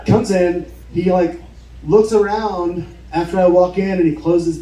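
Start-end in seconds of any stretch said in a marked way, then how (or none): none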